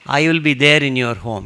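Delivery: the saint insisting he will be in the devotee's home; emphatic promise. Retold or imitated. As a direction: promise it loudly, pressing each word. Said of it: "he says emphatically".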